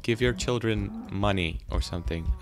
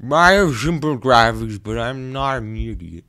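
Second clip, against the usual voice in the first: game's voice; 7 dB louder than usual. Acted. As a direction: speak as the game itself. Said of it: "you're making this voice".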